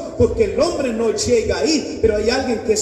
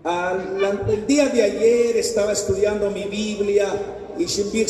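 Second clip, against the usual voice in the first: ordinary delivery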